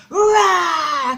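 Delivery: put-on big loud roar for the children; dragon roar.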